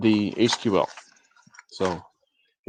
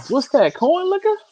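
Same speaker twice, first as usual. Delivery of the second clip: high squeaky read; 6 dB louder than usual. Retold or imitated. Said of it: "Vic said".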